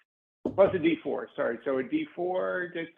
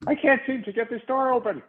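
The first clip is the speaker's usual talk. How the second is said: frustrated complaint; 7 semitones higher than usual. Acted. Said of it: "uh, in great frustration".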